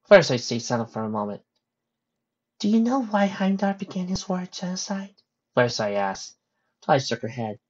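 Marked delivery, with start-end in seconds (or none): 2.6-5.2 high, gentle storytelling character voice. Acted. Pony telling Twilight of Heimdall, high gentle voice.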